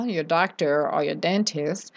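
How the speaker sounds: ordinary talk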